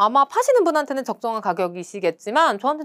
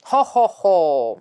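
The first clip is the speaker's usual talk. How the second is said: slow good-natured "허허허" chuckle; hearty mock chuckle; shopkeeper chuckle; 3 semitones lower, 4 dB louder, much slower than usual. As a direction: laugh like a friendly shopkeeper. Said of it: "이렇게 대답을 해주시네요. 상점 주인분이".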